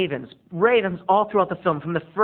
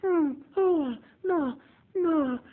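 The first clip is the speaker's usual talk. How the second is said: terrified crying; 12 semitones higher, much slower than usual. Retold or imitated. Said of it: "she's like".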